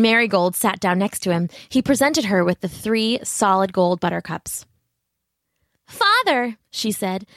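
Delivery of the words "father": put-on much higher little girl voice; sweet young daughter calling her father. A narrator's reading. Young daughter speaks to king.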